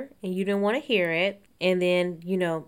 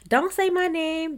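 mock loud talking-over, pitched high; high, droning mock protest; Portia; 9 semitones higher than usual. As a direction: imitate someone insistently drowning others out. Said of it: "doing that thing where you talk loudly".

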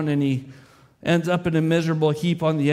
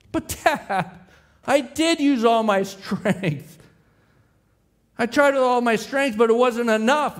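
put-on pleading child; 7 semitones higher than usual. Son pleads in story.

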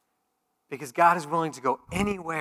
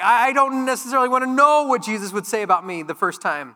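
higher, louder anxious voice; mock reluctant listener; 8 semitones higher, 9 dB louder than usual. Churchgoer afraid of Jesus's verdict, mildly anxious. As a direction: voice a nervous churchgoer. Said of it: "so you're just like".

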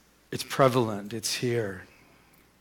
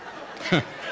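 silent laugh; 5 dB quieter than usual. Real, not acted. Still laughing after the joke.

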